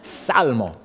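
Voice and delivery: Salmo said the Spanish way, no P in front; Spanish word. Shown as a demonstration. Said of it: "that's why Spanish is so much better".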